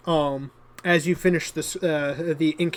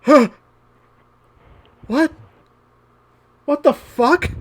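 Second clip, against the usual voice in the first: baffled guards; 9 semitones higher, 6 dB louder than usual. Acted. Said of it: "are just like".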